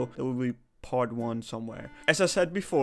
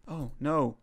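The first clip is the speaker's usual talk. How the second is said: usual speaking voice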